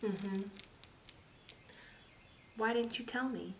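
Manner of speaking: ordinary delivery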